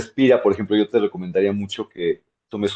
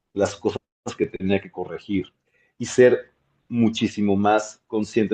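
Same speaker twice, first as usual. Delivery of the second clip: ordinary talk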